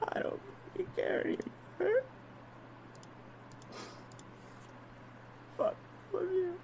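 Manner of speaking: faint, very soft, voice almost gone; barely voiced despair. Real, not acted. Streamer who can't care anymore, barely voiced despair. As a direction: let the words all but disappear. Breaking down after the rant.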